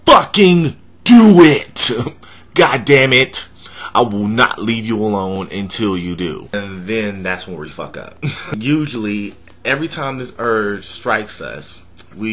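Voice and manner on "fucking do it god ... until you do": louder inner voice. Acted. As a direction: yell at yourself to do it. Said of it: "gets louder and says".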